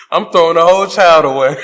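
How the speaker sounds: loud, laughing declaration; laughing outburst. Real, not acted. Comedian abandoning the kid, loud and laughing. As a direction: blurt it through laughter. Panicked joke about the Crooked Man.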